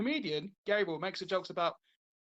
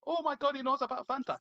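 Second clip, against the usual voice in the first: excited, high Indian-accent imitation; mock Indian accent; 7 semitones higher than usual. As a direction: put on a higher-pitched Indian accent. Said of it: "when you see an India person go".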